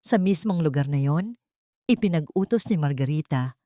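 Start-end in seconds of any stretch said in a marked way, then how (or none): none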